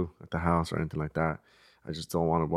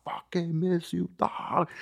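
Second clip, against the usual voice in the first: put-on drunk friend's bear-hug greeting; 9 semitones higher, 4 dB quieter, slower than usual. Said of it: "He's like".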